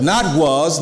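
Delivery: normal talking voice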